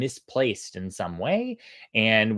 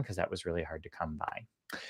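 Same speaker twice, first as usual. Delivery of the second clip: confiding whisper to the listeners; whispered aside; 6 semitones lower, 14 dB quieter than usual. Real, not acted. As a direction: drop to a breathy whisper. Aside about the honeycomb.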